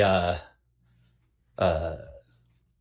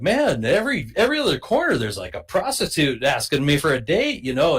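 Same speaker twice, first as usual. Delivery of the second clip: much higher, amazed rush; excited teen; 7 semitones higher than usual. Retold or imitated. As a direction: gush like a stunned sixteen-year-old. Retelling excited teen self.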